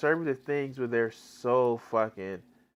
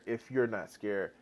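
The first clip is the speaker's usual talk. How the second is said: softer; 4 semitones lower, 7 dB quieter than usual. Real, not acted. Repeats the phrase louder then softer.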